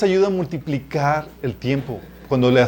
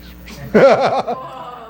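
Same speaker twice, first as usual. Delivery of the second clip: a sudden high burst of laughter; 11 semitones higher than usual. Real, not acted. Preacher laughs at his cheeky bedtime prayer, high laugh.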